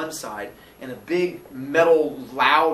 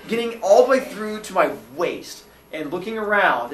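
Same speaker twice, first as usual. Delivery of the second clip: ordinary talk, nothing marked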